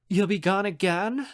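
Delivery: high, pouty question; whining. Acted. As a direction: whine like a let-down girl. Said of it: "Mads whines".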